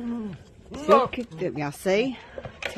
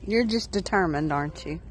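ordinary delivery

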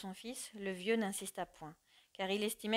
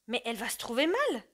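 alarmed cry; 3 semitones higher, 5 dB louder than usual. Acted. Husband cries out as his wife turns pale, alarmed. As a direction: cry out in fear for her. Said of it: "son mari s'écria".

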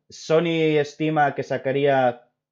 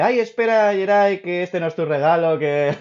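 high, loud imitation of someone cutting in; raised-voice retold outburst; flustered interruption; 8 semitones higher, 6 dB louder than usual. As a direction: mimic the gift giver's sudden alarm. Voicing the gift giver's flustered interruption.

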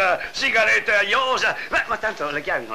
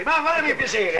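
exasperated; 6 semitones higher than usual. Acted. Jailer rejects absurd horse suggestion.

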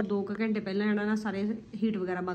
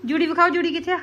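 dramatic loud aunty; 7 semitones higher, 7 dB louder than usual. Real, not acted. Calling baby, showing his topknot.

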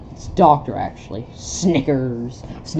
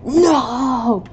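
higher, crying no; anguished cry; 9 semitones higher, 5 dB louder than usual. Acted. Character screaming no, anguished cry. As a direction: cry out in despair.

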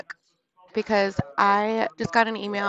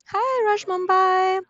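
chat greeting; 9 semitones higher, 3 dB louder, slower than usual. Real, not acted. Greeting stream chat, high drawn.

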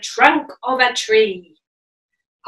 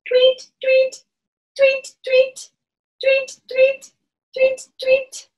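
put-on peeping baby chick; 11 semitones higher, slower than usual. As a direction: chirp it like a tiny chick. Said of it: "You sound like a baby bird".

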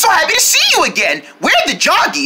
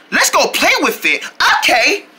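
usual speaking voice

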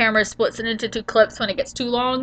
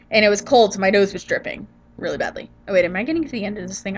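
usual speaking voice

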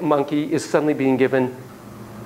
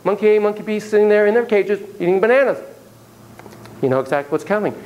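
usual speaking voice

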